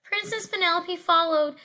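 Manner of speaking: ordinary delivery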